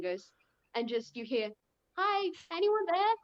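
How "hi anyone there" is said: high, louder searching call; questioning hellos, pitched up. Acted. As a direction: call out to see who answers. Telepathic character calling into someone's head.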